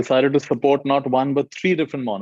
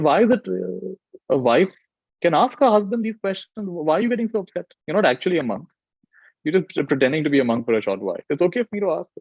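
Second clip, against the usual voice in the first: higher-pitched wife, puzzled and pushing back; 7 semitones higher than usual. Retold or imitated. Wife answering her upset husband, higher and questioning. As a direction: lift the pitch to voice the wife's questions.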